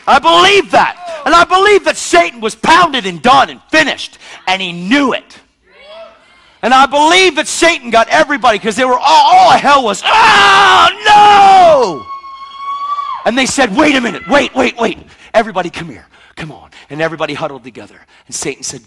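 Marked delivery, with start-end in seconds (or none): 0.1-10.0 loud, high, driving sermon; preaching at full force. Acted. Preaching on Satan's defeat. 10.1-12.1 loud, high cry of alarm from hell; panicked shout. Retold or imitated. All of hell cries out. 13.2-16.7 loud, high, driving sermon; preaching at full force. Acted. Preaching on Satan's defeat.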